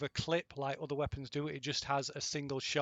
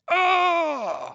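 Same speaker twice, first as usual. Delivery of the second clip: surprised yelp; 14 dB louder than usual. Real, not acted. GM reacts to dropped call.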